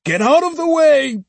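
put-on high, loud old man's cry. Old uncle yelling at his sister-in-law, high loud cry. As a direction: shout her out of the way. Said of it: "he cried to Osugi".

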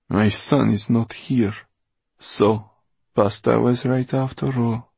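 measured, accusing build; Mortola, bitter and grieving. Acted. Mortola confronting Mo over her dead son, bitter.

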